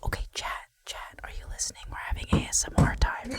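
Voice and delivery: hushed whisper to chat. Real, not acted. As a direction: whisper so the neighbors can't hear. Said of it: "I have to whisper this".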